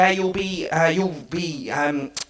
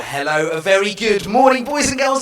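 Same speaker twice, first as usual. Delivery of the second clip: big cheerful host greeting; excited show host; 8 semitones higher, 4 dB louder than usual. Real, not acted. Kids' show host opening greeting.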